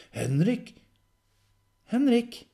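high, loud name call; urgent calling. Acted. Calling Henrik out of his vision, urgent shout. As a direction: call out to snap someone out of it.

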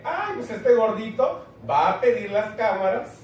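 a hint of gleeful revenge; mock scheming. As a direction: gloat about exposing them. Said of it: "Eso fue lo que dije".

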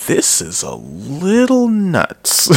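high, drawn-out "little nuts"; slow, swooping mock disbelief; mock bewilderment. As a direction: stretch it out high, as a playfully baffled reaction. Voicing friends' reaction to wild track.

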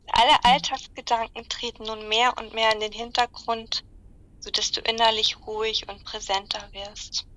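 slow, calm meditation voice; guided meditation. Acted. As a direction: guide them gently into relaxation.

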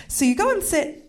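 ordinary talk

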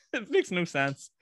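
high laughing voice, slowed. Real, not acted. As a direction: laugh through the words. Laughing at own mixed phrase.

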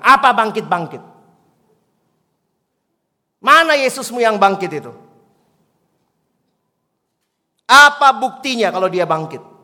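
loud, scornful challenge; old man mocking the girl's faith, shouting; angry old man. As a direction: bark it at the child like a hostile old man. Hostile old man retorts.

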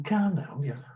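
high, sing-song mocking repeat of calm down; mocking echo. Footballer mocking the referee's calm down, high and sneering. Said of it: "And he's gone".

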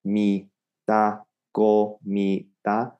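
"Go, mi, da" one separated syllable at a time; slowed syllables. Shown as a demonstration. Teacher drills for accuracy, "Go, mi, da" slow and separated. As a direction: spell out ko, mee, da slowly with gaps. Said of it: "slow right down".

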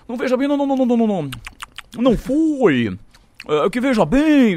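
high, loud string of noes; exaggerated high-pitched refusal; retold flustered denial. Retold official denying the sacking.

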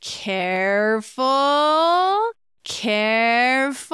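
a stretched cautionary careful; warning voice. Shown as a demonstration. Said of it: "hear my voice in your head saying".

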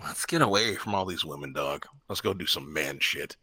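low, soft, sultry man's voice. Retold or imitated. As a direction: purr it low and smooth. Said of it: "Mister Sultry Voice".

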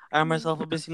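ordinary delivery

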